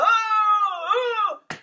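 high, drawn-out wailing moan; re-enacted howl. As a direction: howl out the release. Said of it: "I got through howling".

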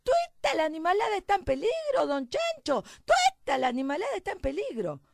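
high, loud rustic little mouse; alarmed shouting. Acted. Little mouse warning all the farm animals, frantic shouting. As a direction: cry the alarm across the farm, first word shrieked high.

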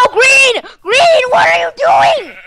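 loud, high shout at a teammate; exasperated yell. Real, not acted. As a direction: yell it in disbelief, high and drawn out. Yelling at teammate in game.

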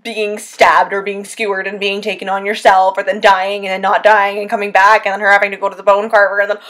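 ordinary talk

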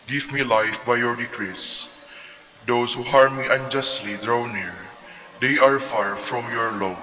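ordinary talk, nothing marked

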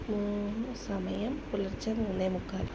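near whisper so the sleeping babies stay asleep; hushed. Real, not acted. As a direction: keep it barely audible at night. Night, babies sleeping nearby. (ഉറങ്ങുന്ന കുഞ്ഞുങ്ങൾക്കരികിൽ പതിഞ്ഞ ശബ്ദം)

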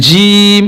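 "জিম" with a long held vowel; teacher stretching "জিম" on one tone; elongated sound. Shown as a demonstration. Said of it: "টান দিয়ে বলেছি".

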